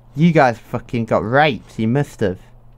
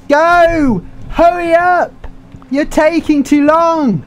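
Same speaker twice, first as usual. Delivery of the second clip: high, loud yelling at the boat; frantic yell; 12 semitones higher, 4 dB louder, much slower than usual. Real, not acted. Gamer yelling at his boat, high and loud. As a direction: scream at the slow boat to go.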